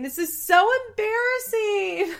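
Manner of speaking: high, wailing imitated sob; mock sobbing. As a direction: whimper it like a crying bride-to-be. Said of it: "Ashley starts sobbing".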